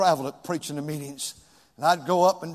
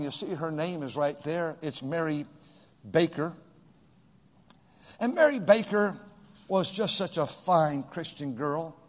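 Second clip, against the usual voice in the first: ordinary talk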